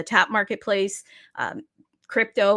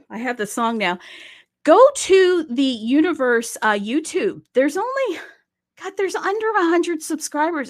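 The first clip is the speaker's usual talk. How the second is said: excited; 6 semitones higher, 5 dB louder than usual. Real, not acted. Urging viewers to subscribe, worked up.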